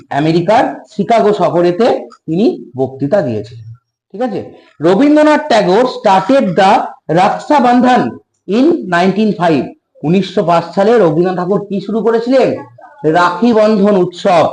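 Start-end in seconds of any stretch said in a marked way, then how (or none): 4.8-9.6 slow dictation. Shown as a demonstration. Teacher dictating English slowly.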